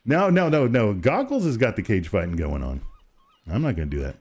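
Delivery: ordinary delivery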